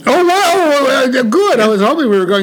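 high, loud gush of delight; excited. Real, not acted. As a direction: sound thrilled. Excited reaction to story offer.

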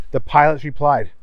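ordinary delivery

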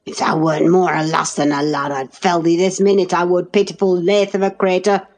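rough Yorkshire lad, angry; angry retort. A narrator's reading. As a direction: snap it back in rage. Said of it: "retorted the angry boor".

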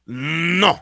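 "No" with a long held n, then a loud punch; drawn-out "Nnn-No", released hard; French Canadian no. Shown as a demonstration. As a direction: hold the N of "No", then let it burst out high. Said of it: "you know how you say, um, no in".

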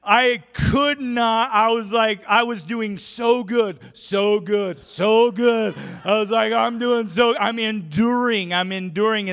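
loud, high exasperated pleading. Retold or imitated. Pastor reliving his struggle to endure, loud and exasperated. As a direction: lay the frustration on, loud and high.

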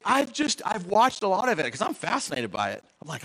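normal talking voice

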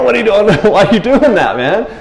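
a laughing, baffled protest at Jesus; laughing disbelief. Retold or imitated.

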